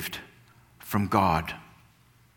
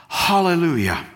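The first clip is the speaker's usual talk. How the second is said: loud, higher "Hallelujah" called out; cried out hallelujah; 6 semitones higher, 7 dB louder than usual. Acted. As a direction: ring it out like a great multitude. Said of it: "crying out".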